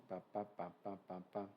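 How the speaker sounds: mumbled filler. Acted. Filler sounds, far timbre.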